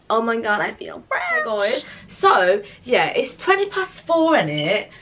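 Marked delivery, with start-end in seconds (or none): none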